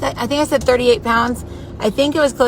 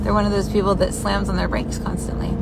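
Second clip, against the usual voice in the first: whispering; 4 dB quieter than usual. Real, not acted. Annoyed at driver ahead.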